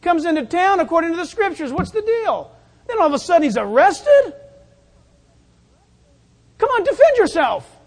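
high, loud indignant crowd voice; incredulous challenge. Acted. Voices doubting crowd challenging arrested Jesus.

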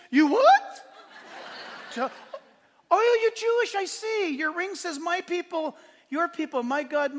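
a seller who can't believe his ears; astonished seller. Retold or imitated. The kiosk seller gasps at the Hebrew ring, amazed.

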